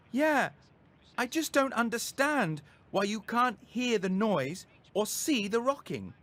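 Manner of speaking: put-on boy protesting to his disbelieving mum; frustrated boy. A narrator's reading. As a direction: protest that nobody else notices. Abdu frustrated not believed.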